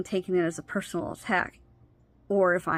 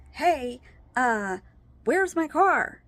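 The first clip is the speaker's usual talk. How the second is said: higher, louder mock demand; retold demanding call; 6 semitones higher, 4 dB louder, much slower than usual. Wife calling husband about her missing car, mock indignant. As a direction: push the question up in indignation.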